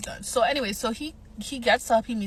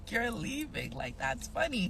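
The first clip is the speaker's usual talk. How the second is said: laughing quote; 8 dB quieter, slower than usual. Retold or imitated. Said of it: "he low-key starts laughing".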